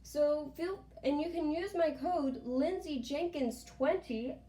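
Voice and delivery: upbeat influencer plug, voice lifted high; sponsor ad read. Real, not acted. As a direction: pitch it to viewers with bouncy emphasis. Delivers sponsor promo to camera.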